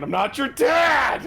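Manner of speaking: high, loud, drawn-out yell; distant shouted denial. Acted. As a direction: shout it back as you flee. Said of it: "You hear in the background".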